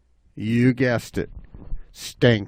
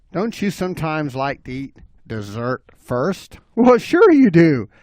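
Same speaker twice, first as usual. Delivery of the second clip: usual speaking voice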